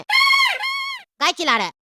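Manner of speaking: high, slow comic yell; hotel owner yells at quarrel; mock shout. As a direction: stretch it out loud.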